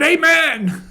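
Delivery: loud, high-pitched shout of amen. Retold or imitated. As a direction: shout it out like a lone voice in the crowd. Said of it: "a guy who shouted".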